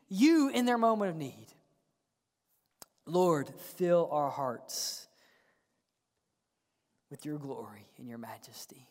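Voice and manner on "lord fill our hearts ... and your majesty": reverent, unhurried petition; hushed prayer. Real, not acted. Turns from preaching to prayer.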